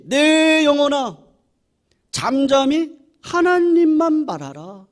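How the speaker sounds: loud, slow proclamation to one's own soul; a preacher commanding his own soul to be still; proclaiming to the soul. Shown as a demonstration. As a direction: call out to your soul, slow and strong. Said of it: "내 영혼을 향해서 선포하는 거예요".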